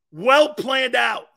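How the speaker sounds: slow, loud mocking repeat; slow sarcastic jab. Real, not acted. Mocking the coach, slow loud sarcasm. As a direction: drag it out with scorn.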